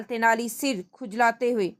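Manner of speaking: ordinary talk, nothing marked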